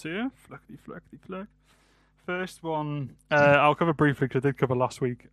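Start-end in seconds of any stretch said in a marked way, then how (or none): 0.5-1.5 soft whispered sing-song flicking; whispered flickety. Acted. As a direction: whisper it playfully. Playful noise while flicking records.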